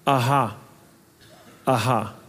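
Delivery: a low gloating taunt from the mockers; mocking aha. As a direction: sneer it like a gloating enemy. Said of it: "Kids, that's kind of fun".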